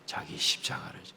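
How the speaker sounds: a low reverent whisper; whispered scripture; hushed whisper. Acted. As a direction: breathe the words out, barely voiced. Quoting the Lord's command softly.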